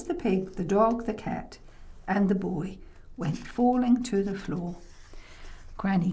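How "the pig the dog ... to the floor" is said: usual speaking voice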